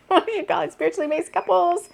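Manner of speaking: high, laughing through the words; laughing self-correction to listeners. Real, not acted. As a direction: giggle while fumbling for the phrase. Laughs at her own phrasing.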